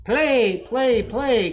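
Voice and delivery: high, loud eager plea; excited baby goose crying out. A narrator's reading. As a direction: beg to play, bouncing with glee. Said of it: "cried the excited baby goose".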